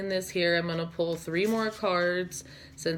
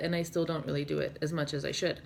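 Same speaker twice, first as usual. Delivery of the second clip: ordinary talk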